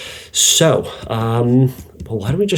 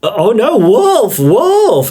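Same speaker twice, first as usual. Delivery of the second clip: high, louder hammed-up cry of wolf; fake wolf alarm; 10 semitones higher, 4 dB louder, much slower than usual. Boy faking danger again to the villagers, high hammed-up cry. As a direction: overact the panic, calling for help. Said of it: "And he goes".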